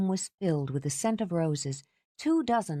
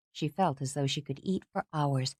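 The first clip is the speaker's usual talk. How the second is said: usual speaking voice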